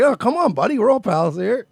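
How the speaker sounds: high, hammed-up buddy-buddy coaxing; chummy host voice. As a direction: coax them like old pals. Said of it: "You're like".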